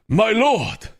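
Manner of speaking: high, dramatic samurai-game announcer; Shogun two voice. Pretend. Streamer drops into a Shogun 2 voice, high and dramatic. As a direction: cry it out like a Shogun 2 unit line. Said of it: "my Shogun two voice".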